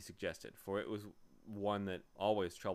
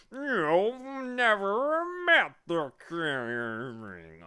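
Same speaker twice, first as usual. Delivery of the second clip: slow, high, loud bee outburst; angry accusation; 10 semitones higher, 14 dB louder, much slower than usual. A narrator's reading. Bee exposing the humbug's boast, angry drawn-out voice. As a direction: accuse with long, stretched words. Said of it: "accused the bee angrily".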